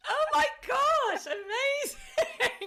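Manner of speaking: high, loud squeal of surprise, laughing; shocked and thrilled, laughing out the last word slowly; high squeal, amazing stretched out with a laugh; delighted surprise. Real, not acted. Host reacts to vibrator news.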